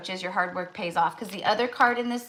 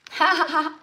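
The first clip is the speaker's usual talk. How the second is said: short laugh; 9 semitones higher, 6 dB louder than usual. Real, not acted. Laughing mid-reading.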